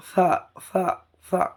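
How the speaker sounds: th-like সা instead of a clean s; lisped articulation. Shown as a demonstration. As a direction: put tongue to teeth and lisp সা. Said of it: "তোতলার মতো".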